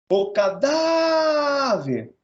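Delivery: "cadáver" called high, DÁ held very long; "cadáver" drawn out on the middle syllable, then falling; calling the word. Shown as a demonstration. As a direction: hail "cadáver" like a person, stretching the DÁ. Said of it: "Chama ele".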